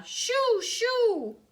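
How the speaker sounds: very high, loud shooing cries; two piercing, hooted shouts; hollering. A narrator's reading. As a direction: shriek it to chase birds away. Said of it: "hollered Grandma".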